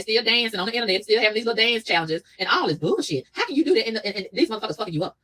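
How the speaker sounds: ordinary talk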